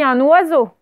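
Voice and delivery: "un oiseau" linked as "un-noiseau"; with liaison. Shown as a demonstration. Teacher points out what pupils heard, liaison "un-noiseau". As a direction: join "un oiseau" with an n sound. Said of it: "je fais la liaison".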